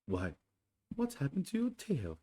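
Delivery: be startled by the missing tail; high surprised bear voice; surprised Pooh. Acted. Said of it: "he said in surprise".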